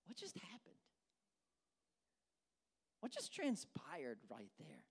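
hushed, half-whispered disbelief; stunned whisper. Acted. Preacher reacting to the prophet's sudden fear, stunned whisper. Stunned aside after the story.

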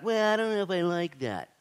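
mock a hesitant objector; reluctant objection. Voicing reluctant listeners' objection.

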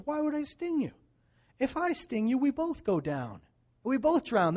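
high-pitched, incredulous protest; persuading scorpion. Acted. Scorpion pleads his case in fable.

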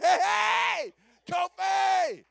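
loud, high-pitched excited holler; big stretched-out shout to a friend. Retold or imitated. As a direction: holler out in thrilled admiration. Excited greeting of freshly faded man.

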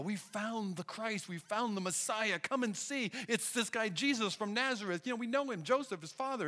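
high, eager announcing of good news; excited news. Retold or imitated. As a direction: burst in with the news. Philip tells Nathanael excitedly.

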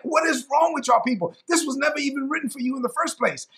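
exasperated, high-pitched scolding; acted-out outburst of frustration; frustrated. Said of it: "this is why it frustrated Paul".

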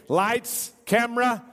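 playful director cueing the scene, building to action; film director's call. Pretend. Playing director, calls action.

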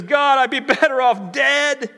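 high, loud, slow wail of self-pity; Jonah's despair. Acted. Jonah complaining to God he'd rather die, high loud slow wail. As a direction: wallow in melodramatic misery.

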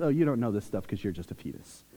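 imitating a belittling older sister; condescending sisterly tease. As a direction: put down little sister offhandedly. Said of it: "they call her a fetus".

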